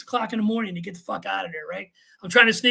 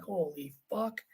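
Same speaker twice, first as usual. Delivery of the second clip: soft, sinking "holy fuck"; hushed dread; 3 semitones lower, 12 dB quieter, slower than usual. Retold or imitated. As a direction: breathe it out as you realize you're doomed. Dread as the chief comes.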